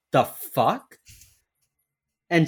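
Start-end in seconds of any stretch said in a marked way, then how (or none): 0.1-1.0 imitated affronted disbelief, rising; mock-offended, questioning at the end. Repeating the colleague's out-loud reaction, mock-offended. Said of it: "He is so offended".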